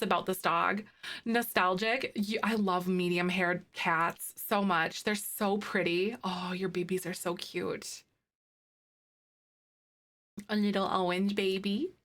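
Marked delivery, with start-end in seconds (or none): none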